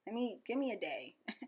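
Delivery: soft resigned self-talk; weary whisper. Retold or imitated. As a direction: mutter it under your breath, tired of yourself. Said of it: "I just be like".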